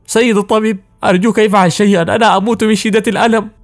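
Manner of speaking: high-pitched plea through pain; desperate, broken voice. A narrator's reading. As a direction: beg the doctor as if dying. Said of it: "بيأس وقال بصوت متقطع". (توسل يائس بصوت متقطع ومرتفع الطبقة)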